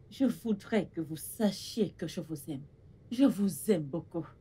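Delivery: low, soft motherly declaration; tender. Acted. Mother telling her daughters she loves them, tender. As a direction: speak softly from the heart.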